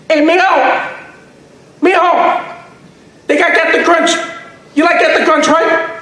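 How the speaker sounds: mom calling out. Retold or imitated. Mom calling across the store.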